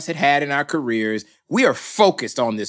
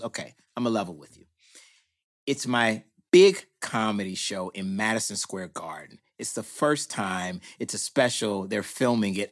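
lower, softer, a little guilty; low sheepish confession; 7 semitones lower, 7 dB quieter than usual. Retold or imitated. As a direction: confess it quietly like a sheepish friend.

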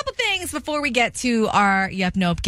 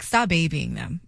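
husband; 6 semitones lower, slower than usual. Retold or imitated. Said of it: "But my husband's like you".

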